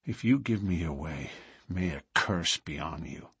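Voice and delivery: low, soft curse; grave warning. A narrator's reading. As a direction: warn him quietly and in earnest. Fearful man threatens a curse.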